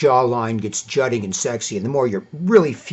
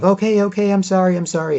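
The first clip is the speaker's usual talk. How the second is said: high, hurried sorry; hammed-up girlfriend caving in; mock apologetic girlfriend; 9 semitones higher than usual. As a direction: pitch up and plead quickly.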